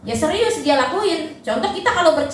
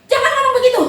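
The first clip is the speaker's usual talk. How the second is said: high, loud laughing mock scold; scolding parent; 10 semitones higher, 5 dB louder, much faster than usual. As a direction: snap it at the child half-laughing. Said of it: "Kalau kita cuma bilang".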